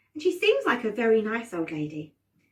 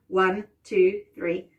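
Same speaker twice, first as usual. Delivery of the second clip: plain reading voice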